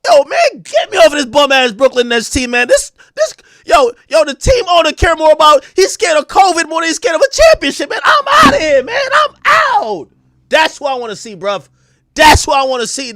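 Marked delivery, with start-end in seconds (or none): 0.1-10.1 high, loud, whiny wail of a fed-up player; hammed-up sobbing trade demand; mock crying rant. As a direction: wail like you're begging to be traded. Imitating a player's tearful rant.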